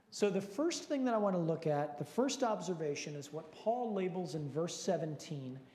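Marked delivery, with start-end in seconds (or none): none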